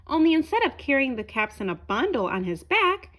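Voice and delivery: plain reading voice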